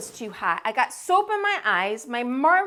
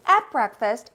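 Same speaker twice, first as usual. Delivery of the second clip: ordinary delivery, nothing marked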